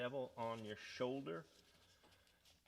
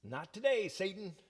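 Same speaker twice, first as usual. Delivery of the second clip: defiant slogan; 10 semitones higher, 6 dB louder than usual. Retold or imitated. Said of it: "It was like".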